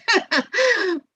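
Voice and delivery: quick laugh at the interruption; amused laugh. Real, not acted. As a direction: laugh it off with a chuckle. Interrupted by Calvin chiming in.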